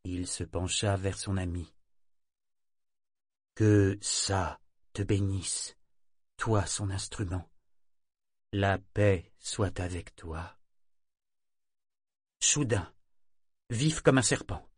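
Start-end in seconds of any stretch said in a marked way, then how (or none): 3.6-10.6 slow, gentle benediction; slow blessing. Acted. Bénédiction du prêtre à son ami. (bénédiction lente et douce)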